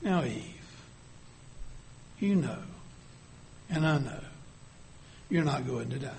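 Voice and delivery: soft, low-key and sly persuasion; subtle commanding voice. Acted. Serpent quietly persuading Eve, subtle and commanding. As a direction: coax her quietly as the serpent. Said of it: "in a more subtle, commanding voice".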